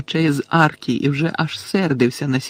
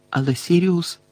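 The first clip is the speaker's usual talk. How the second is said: ordinary delivery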